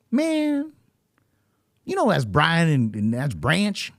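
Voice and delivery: high-pitched drawn-out impression; Ronnie. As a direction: imitate a friend's high, slow exclamation. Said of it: "And, uh, he goes".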